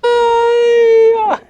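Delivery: sudden high yelp; startled shout, pitch jumps; surprised cry. Real, not acted.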